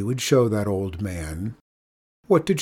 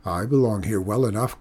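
ordinary delivery, nothing marked

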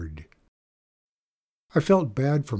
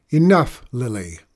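firm interruption; 6 dB louder than usual. Acted. Mom stopping her daughters' argument, interrupting firmly. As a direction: cut the child off firmly. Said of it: "Mom interrupted".

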